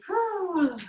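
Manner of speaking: high, falling "whew"; exhaled whew. Shown as a demonstration. Teacher shakes out bee breath, high whew. Releasing after humming exercise.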